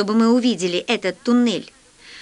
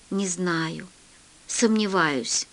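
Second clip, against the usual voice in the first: low, very soft and hesitant; hushed, slow, doubtful reply; grave doubt; 4 semitones lower, 7 dB quieter, slower than usual. A narrator's reading. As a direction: answer quietly, as if reluctant to give bad news. Doctor doubts patient can be saved.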